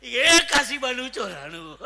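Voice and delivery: loud, slow holler; imitated shout. Comic re-enacts the man yelling a phone number, shouting. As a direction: call it out loud and stretched. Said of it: "ઓલો કઈનો રાડો રાખે ને".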